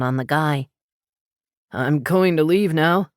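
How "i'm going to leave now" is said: backing away. A narrator's reading. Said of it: "he said, inching back".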